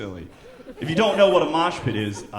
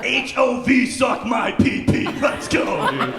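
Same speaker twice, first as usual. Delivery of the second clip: mock hype; 7 semitones higher, slower than usual. Yelling at carpool lane.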